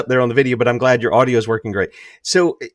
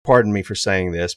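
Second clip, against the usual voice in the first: normal talking voice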